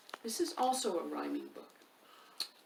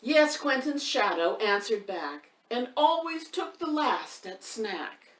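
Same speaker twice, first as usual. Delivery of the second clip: louder, higher storybook recital with a bouncing beat; lively rhyme reading; 5 semitones higher, 7 dB louder, slower than usual. A narrator's reading. Reading a rhyming book aloud to young children, loud and sing-song. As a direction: read the rhyme out big for the little ones, leaning on the rhythm.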